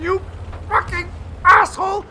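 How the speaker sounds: high, loud squealing curse; tank voiced cursing. Commentator voicing the Swedish tank, high furious curse. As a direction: scream it like a furious little tank. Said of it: "He's going".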